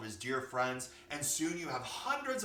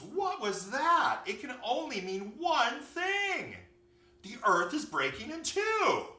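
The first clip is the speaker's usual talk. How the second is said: high, loud, drawn-out alarm; panicked little bunny, slow and high; scared bunny; 11 semitones higher, 7 dB louder than usual. A narrator's reading. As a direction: cry out the dreadful news in panic. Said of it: "being very scared and fearful".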